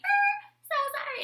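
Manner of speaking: very high apologetic squeak; old lady apology. Retold or imitated. Old driver to the fallen woman, high apology. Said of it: "And she".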